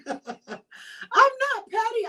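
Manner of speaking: slow laugh through the words; laughing while denying being petty; laughing denial. Real, not acted. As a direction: laugh it off, not petty at all.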